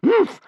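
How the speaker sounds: high, loud scornful sniff; disdainful humph. A narrator's reading. Grandmother sniffs at Hiccup's plight, scornful humph. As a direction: sniff it with contempt. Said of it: "sniffed Bear Cub's grandmother".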